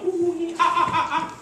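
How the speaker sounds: high whooping chimp call; chimp party call. Retold or imitated. Lecturer imitating chimps partying with females. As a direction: hoot like a happy chimp at a party. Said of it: "they go".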